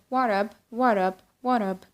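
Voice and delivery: "What up" with no S sound; soft, casual "What up"; casual reduced greeting. Shown as a demonstration. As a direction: drop the S and link "What up". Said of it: "say it in a lot of ways".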